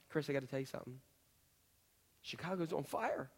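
hushed, telling a friend the fire news; quiet mock confidence; confiding bad news. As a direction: lean in and break it to Chris softly. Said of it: "If I said to you".

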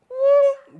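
high loud showman whoop; hammed-up healer whoop; mock whoop. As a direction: throw out a big miracle woo. Mocking a flashy instant healing.

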